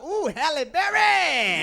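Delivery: very slow, drawn-out admiring ooh. Retold or imitated. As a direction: savour the compliment. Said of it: "I was like".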